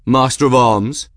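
lightly voiced cocky challenger, projected; mocking swaggerer. A narrator's reading. Challenger greeting the master of arms, mocking. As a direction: call out with mock deference. Reggie greets Estabrook with mock bow.

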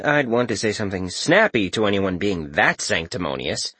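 usual speaking voice

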